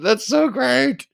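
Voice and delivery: very high, drawn-out squeal of delight; slow, tickled squeal; delighted gushing. Real, not acted. Gushing about the favorite clip.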